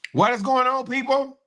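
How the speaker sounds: high, loud hype greeting; big high opener shouting to the audience. Real, not acted. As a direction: call out to the viewers with energy.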